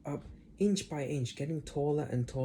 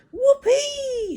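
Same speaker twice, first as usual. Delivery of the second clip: very high, much louder cackling whoop; screechy old woman shouting; 10 dB louder than usual. Acted. Grandma whooping as she grows through floor, screechy. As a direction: whoop like a wild old hag. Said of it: "she shouted".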